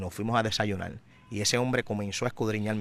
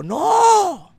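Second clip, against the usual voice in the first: sudden high, loud shout of refusal, then slower; retold shout; 10 semitones higher, 9 dB louder, much slower than usual. Santero yelling at the boy at his gate, high loud shout. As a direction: bark the no, then hold the line slowly. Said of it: "y me gritó".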